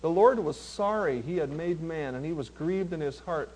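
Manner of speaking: low, hushed verse reading; reflective rereading. Shown as a demonstration. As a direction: read it softly and let it sink in. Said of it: "read them again real slow and let it sink in".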